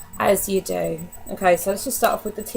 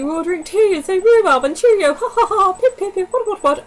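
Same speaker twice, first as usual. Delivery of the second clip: high, loud hammed-up posh English; laughing parody of a tea-drinking Englishman; mock posh Brit; 10 semitones higher, 5 dB louder than usual. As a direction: send up the Americans' idea of Brits. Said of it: "the Americans' take on British".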